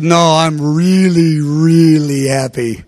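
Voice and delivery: slow, low, drawn-out contentment; imitating a blissed-out smiler, slowly; retold happiness. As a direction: stretch each "really" with deep satisfaction. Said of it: "I'm smiling".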